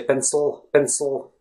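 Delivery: "pencil" cut short, with no vowel before the l; sharp abrupt ending. Shown as a demonstration. Teacher showing what not to say, "pencil" ended abruptly. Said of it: "don't finish the word so sharply and abruptly".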